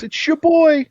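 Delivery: drawn-out, high-pitched hype yell. Retold or imitated. Everyone quoting the rapper at him, high imitated yell. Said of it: "when [name] yells".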